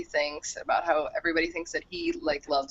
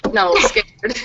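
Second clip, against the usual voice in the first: scared said louder to correct a mishearing; 2 semitones higher, 11 dB louder, much slower than usual. Shown as a demonstration. Correcting her friend who misheard scared as screwed, louder. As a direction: repeat the word scared, louder and clearer. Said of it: "you're not screwed".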